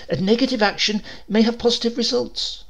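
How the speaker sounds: thin reasoning voice. A narrator's reading. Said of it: "Hill's thin voice was always the voice of reason".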